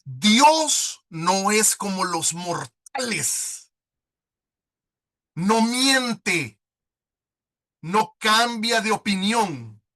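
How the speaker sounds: slow, solemn reading of scripture. Acted. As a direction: read each phrase slowly, letting it weigh. Reads scripture slowly. (lectura solemne y pausada de la Biblia)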